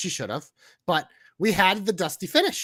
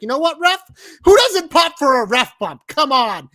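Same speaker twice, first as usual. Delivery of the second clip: louder, higher, taunting the referee; angry Rousey; 5 semitones higher, 9 dB louder than usual. Retold or imitated. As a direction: goad the ref before the attack. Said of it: "she's like".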